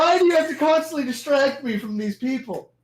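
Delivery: high-pitched, exasperated protest; exasperated complaint. Real, not acted. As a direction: complain at someone who keeps distracting you. Scolding a player for distracting him.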